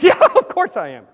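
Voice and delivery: loud, laughing eager yes; laughing boast. Acted. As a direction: laugh it off proudly. Abraham boastfully agrees.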